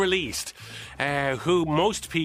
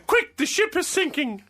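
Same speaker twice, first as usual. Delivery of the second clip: high-pitched spoken diva impression; badly faked high singer's voice; 11 semitones higher than usual. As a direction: say it high, without singing.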